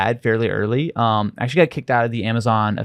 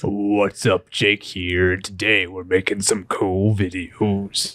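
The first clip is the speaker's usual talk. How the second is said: lower, slow mock deep voice; deep-voiced YouTuber intro; 5 semitones lower, much slower than usual. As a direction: lightly imitate a deep-voiced kid host. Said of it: "I just had this deep voice".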